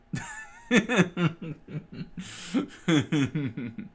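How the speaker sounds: high, loud hammed-up cackle; loud villain laugh. Krieg gloats over the room, loud villain laugh.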